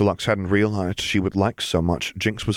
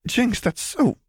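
almost sobbing; slower than usual. Acted. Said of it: "Lux almost sobbed at that".

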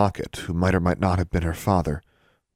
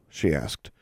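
plain reading voice

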